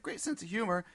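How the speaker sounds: mildly mocking "Great sense of humor" quoting profiles; lightly sneering at cliché profiles; mocking quote.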